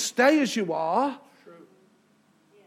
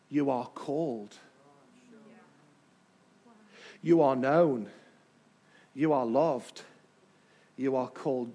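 much lower and softer, measured; hushed; 10 semitones lower, 6 dB quieter than usual. Real, not acted. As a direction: drop to a hush, one phrase at a time.

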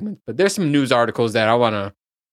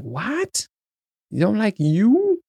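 higher-pitched mock disbelief; incredulous; 8 semitones higher, much slower than usual. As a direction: draw it out as a baffled question. Acted disbelief at others' opinion.

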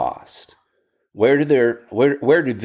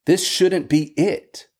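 higher-pitched, pleading protest; crying out in protest; 6 semitones higher than usual. Retold or imitated. Preacher voicing lament crying out, pitched up. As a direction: cry out against the wrongness. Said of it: "Lament cries out".

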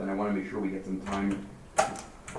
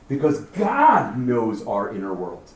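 slow and louder, weight on "God"; emphatic; 6 dB louder, slower than usual. Shown as a demonstration. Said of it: "in the emphatic".

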